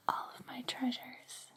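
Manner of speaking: ordinary talk, nothing marked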